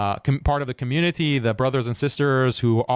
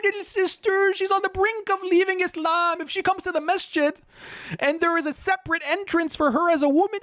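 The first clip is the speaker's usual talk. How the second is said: very high, sobbing falsetto parody; hammed-up weeping plea to the mullahs; mock crying. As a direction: wail it like fake tears to guilt-trip. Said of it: "the tears start coming down".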